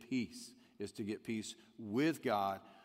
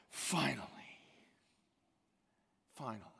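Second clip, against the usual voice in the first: whispered relief. Acted. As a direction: breathe it out at last. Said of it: "God says".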